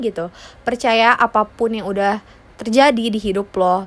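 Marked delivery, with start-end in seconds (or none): none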